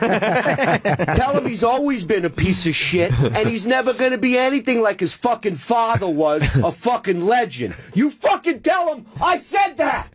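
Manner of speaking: high mock tirade building to a drawn-out shout; imitating Dice. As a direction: work up into a yelled insult. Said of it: "points his finger, and goes".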